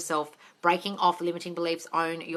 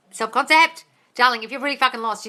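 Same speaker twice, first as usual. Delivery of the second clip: exasperated; 4 semitones higher, 8 dB louder than usual. Real, not acted. Exasperated with viewer.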